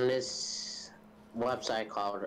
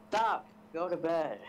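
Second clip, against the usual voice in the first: high, sharp mock yell; father's order; 8 semitones higher than usual. Story father ordering his son to bed, high-pitched yell. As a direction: call out a high-pitched command. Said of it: "He told the boy to".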